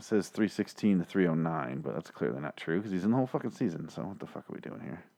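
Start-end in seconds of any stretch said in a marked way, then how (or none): 3.9-5.0 half-voiced murmur while searching; under-breath mutter. Real, not acted. Muttering to himself while looking something up online, whispered. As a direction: whisper it to yourself, distracted.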